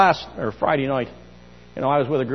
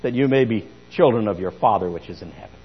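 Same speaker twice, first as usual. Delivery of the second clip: louder proclaiming of the verse; preaching scripture; 5 dB louder than usual. Acted. Preacher proclaiming Jesus's commands to the church. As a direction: declare the commands to the congregation.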